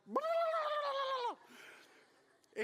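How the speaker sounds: high, loud gibberish shout; mock yelling. Pastor mimicking the raging driver with a high, loud blah shout. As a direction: mirror her rage back at her in a shrieking burst. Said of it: "I'm just like".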